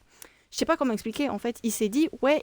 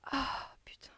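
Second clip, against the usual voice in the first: whispered swear under breath; whispered curse; 4 semitones higher, 15 dB quieter, slower than usual. Real, not acted. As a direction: hiss it softly. Tense moment in game.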